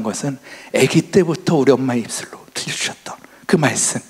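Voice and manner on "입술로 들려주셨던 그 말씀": whispered with emotion; hushed memory of mother's voice; soft whisper. Real, not acted. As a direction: let it fall to a hush. Remembering mother's words.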